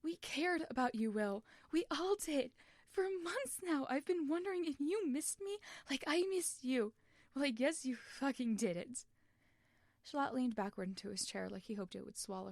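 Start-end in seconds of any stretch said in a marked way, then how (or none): 0.0-9.1 louder, higher, voice cracking with betrayal; boy raging at his brother; hurt angry outburst. Acted. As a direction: accuse him through tears. Tommy's continued tearful outburst.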